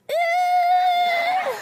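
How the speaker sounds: high shocked squeal; shocked yelp. Retold or imitated. Learning the date's mom is young, a high shocked squeal. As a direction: shriek in mock disbelief.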